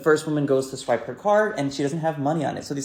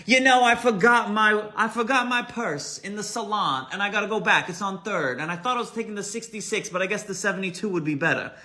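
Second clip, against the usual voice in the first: loud bombastic woman; 7 semitones higher than usual. Retold or imitated. Queen of the bus announcing her lost purse, bombastic. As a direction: sweep in with a bombastic diva swagger. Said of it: "she enters in a very loud voice, bombastic attitude".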